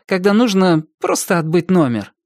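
ordinary delivery, nothing marked

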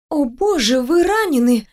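alarmed cry, pitch jumping up; exclaiming. A narrator's reading. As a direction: gasp it out in shock. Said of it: "воскликнула я".

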